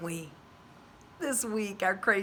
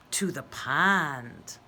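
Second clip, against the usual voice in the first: plain reading voice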